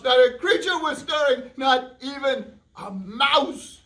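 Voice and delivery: put-on slow, drawn-out reading to children; storybook reading. A narrator's reading. Host reading the poem aloud to kids, slow storyteller. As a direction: read it slowly for the kids.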